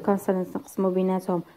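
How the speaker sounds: ordinary talk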